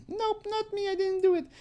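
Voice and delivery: very high mocking falsetto for the girl; mock girly voice. Reading her chat reply in a squeaky falsetto. Mocking the decoy girl's replies.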